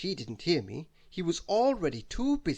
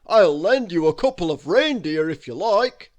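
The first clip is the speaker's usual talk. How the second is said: Father Christmas offering; 3 semitones higher, 12 dB louder than usual. Acted.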